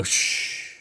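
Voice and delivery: whispered shush. Pretend. Teasing his talkative partner with a whispered shush. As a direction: hush her with a soft whispered shh. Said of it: "I'm gonna go".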